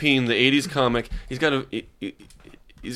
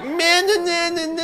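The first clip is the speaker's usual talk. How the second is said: high mock woman voice. Comic mocks women chattering.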